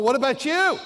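high, loud accusing demand; loud accusing question. Retold or imitated. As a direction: throw it back at her, loud and high. Pressing wife for apology.

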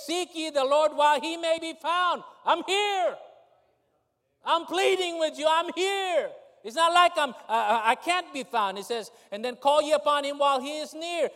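loud, high preacher's cry; impassioned sermon delivery; preaching at full force. Acted. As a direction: preach it out with fire.

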